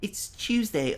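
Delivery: very high mock British accent; butchered British accent. Shown as a demonstration. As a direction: squeak out a bad British accent. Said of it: "I'm butchering your accent".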